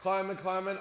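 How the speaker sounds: loud start settling into a steady chant; repetitive climbing. Acted. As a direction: keep repeating it in an unbroken rhythm. Acts out the long climb.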